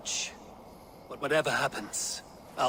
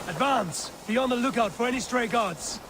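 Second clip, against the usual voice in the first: raised, high commands called across the field; battle orders; 9 semitones higher than usual. Acted. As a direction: bark orders to the raiders mid-assault. Giving orders during the attack.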